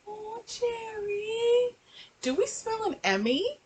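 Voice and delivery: high, slow, soft mock-weepy gushing; mock crying. Vlogger mocking her crying as Emmy bait, high and slow. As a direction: gush slowly in a high weepy voice.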